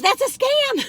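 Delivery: high mock scam call; Penny's warning. Penny retold calling every package a scam, high mock voice. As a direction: squeak it out as a tease. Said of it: "she goes".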